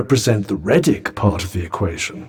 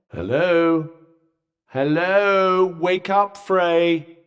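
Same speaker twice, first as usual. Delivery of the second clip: calling to wake someone; 4 semitones higher, much slower than usual. Acted. Calling to rouse unresponsive Frey.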